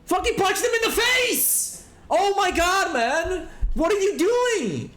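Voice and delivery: exasperated yelling. Real, not acted. Ranting at horror victims, exasperated high yell. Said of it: "I'm screaming too much".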